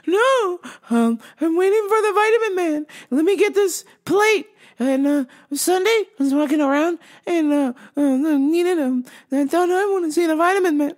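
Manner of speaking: confused customer. Retold or imitated. Customer rambling to clerk about the vitamin man, high and confused. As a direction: trail through broken thoughts in a high voice.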